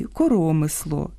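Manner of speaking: «коромисло» stressed on the second syllable, РО; Ukrainian stress. Shown as a demonstration. Host giving the Ukrainian noun, second-syllable stress. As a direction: land on РО in «коромисло». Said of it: "По-різному слід наголошувати".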